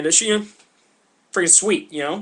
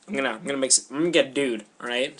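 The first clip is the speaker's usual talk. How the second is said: ordinary delivery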